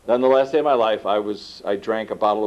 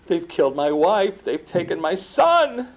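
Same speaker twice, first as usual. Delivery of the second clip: raised, grieving cry over the dead; anguished; 9 semitones higher than usual. Retold or imitated. Retelling the hypnosis vision to the audience, anguished grief. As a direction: relive the loss of his wife and son. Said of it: "I'm fully in that emotion of that".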